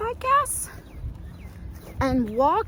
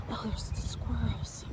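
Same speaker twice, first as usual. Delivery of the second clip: whisper; 8 dB quieter than usual. Real, not acted. Spotting squirrels on walk.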